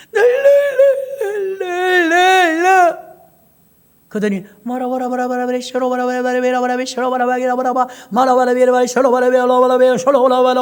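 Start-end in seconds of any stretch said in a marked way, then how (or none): 0.1-3.1 very slow, high, loud 랄랄랄 with crying; 랄랄랄 drawn out high and loud, weeping; crying tongues babble. Retold or imitated. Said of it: "눈물이 나". 4.9-10.6 rapid 뭐라 뭐라 chant growing louder; 뭐라 쇼로 뭐라 chanted fast, swelling to loud; rising tongues chant. Retold or imitated. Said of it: "나오기 시작하는 거야".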